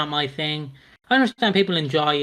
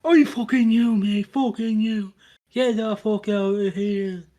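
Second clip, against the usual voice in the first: put-on higher, slurring drunk pub rant at the barman; drunk geezer ranting; 5 semitones higher than usual. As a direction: play the pint-swilling bloke cursing out the barman. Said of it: "slagging off the barman".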